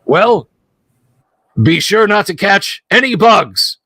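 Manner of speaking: mocking goblin. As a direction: send him off with a sneer.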